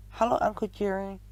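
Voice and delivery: put-on a young nephew's happy squeak; Benjamin squeak. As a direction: greet him like an eager small child. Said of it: "he squeaked".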